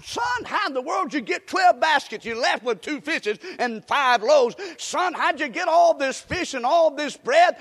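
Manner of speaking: high, loud astonished mother; amazed mother. Acted. Mother astonished at her son's baskets, loud amazement. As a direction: gasp at what the son brought.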